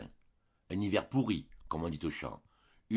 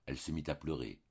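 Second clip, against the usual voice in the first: usual speaking voice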